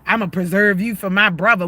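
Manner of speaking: ordinary talk, nothing marked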